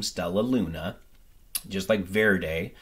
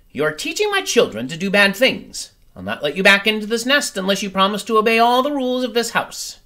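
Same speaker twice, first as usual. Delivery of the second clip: high, loud, fast stern lecture; stern mother bird; 11 semitones higher, 6 dB louder, faster than usual. Acted. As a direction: lay down the house rules. Mother bird lectures Stella Luna.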